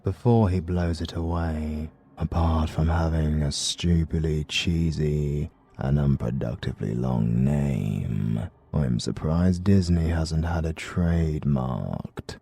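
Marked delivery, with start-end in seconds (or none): none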